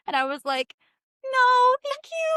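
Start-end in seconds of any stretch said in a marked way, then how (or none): 1.2-2.3 very high-pitched, sing-out brush-off; polite refusal. Retold or imitated. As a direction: turn him down in an exaggerated high voice. Retold polite refusal of a date.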